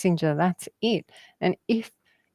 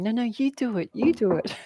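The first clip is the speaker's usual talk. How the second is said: higher, softer nervous little frog; timid frog voice; 5 semitones higher, 4 dB quieter, faster than usual. Retold or imitated. Retelling frogs at the door, timid frog voice. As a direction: hang back like a scared frog. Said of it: "the other frog going".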